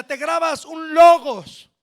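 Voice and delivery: ordinary delivery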